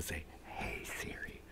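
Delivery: hushed, careful whisper; very soft whisper. Shown as a demonstration. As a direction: whisper it so the assistant won't hear. Avoiding waking up Siri.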